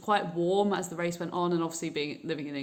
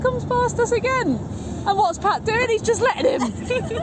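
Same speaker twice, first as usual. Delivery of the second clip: excited high chatter; 14 semitones higher, 9 dB louder than usual. Real, not acted. Mocking teammate in car.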